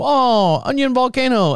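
hammed-up chef hyping the volcano; mock hibachi chef. Chef to diners, high mock showman cry.

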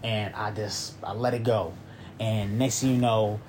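ordinary delivery

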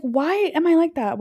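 high-pitched mock self-questioning; mock exasperation. Speaker questioning her own rambling, mock exasperation. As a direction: play up being baffled at yourself, pitched high.

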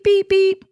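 high, beeping like a backing truck; mock the warning beep of a backing truck; reversing beep. Teasing the host about backing up in aisles, beeping.

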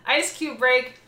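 laughing. Real, not acted. Laughing at ice cube break.

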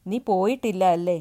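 put-on woman finding the boy still there, yelling; sudden yell. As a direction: yell at him all at once. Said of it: "അവൾ പെട്ടെന്ന് അലറി".

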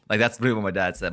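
ordinary delivery

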